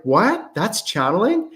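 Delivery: high-pitched surprised question; astonished disbelief. Retold or imitated. Student in an exercise told they're channeling, astonished. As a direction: imitate a student who can't believe it.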